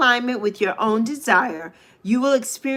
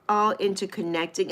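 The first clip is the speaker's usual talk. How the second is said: usual speaking voice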